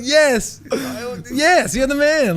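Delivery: high, slow, thrilled "yes yes"; excited cheering. Retold or imitated. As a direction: cheer him on like a fan. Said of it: "And I was like".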